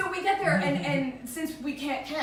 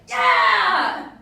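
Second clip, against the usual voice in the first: overconfident yeah; 3 semitones higher, 12 dB louder than usual. Retold or imitated. Bluffing to the rafting guide.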